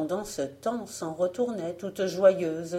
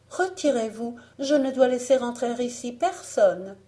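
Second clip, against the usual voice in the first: put-on higher-pitched fairy-tale girl; raised, lighter voice of refusal; refusing young heroine; 5 semitones higher, 3 dB louder than usual. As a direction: turn the visitor away from the window. Blanche refuse depuis la fenêtre.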